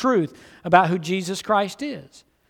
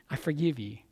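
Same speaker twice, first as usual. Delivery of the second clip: "I forgive you" dropped low and much softer; soft echo; 4 semitones lower, 10 dB quieter than usual. Retold or imitated. Pastor echoing Jesus's words to the congregation, hushed. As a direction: let the repeat fall away quietly. Jesus quoted, echoed softly.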